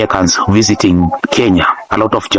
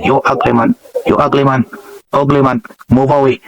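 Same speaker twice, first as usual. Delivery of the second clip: higher-pitched, playful Jamaican patois; 4 semitones higher than usual. Shown as a demonstration. Showing how witty it sounds, in Jamaican patois. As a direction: lightly put on patois for "ugly man". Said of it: "patois sounds".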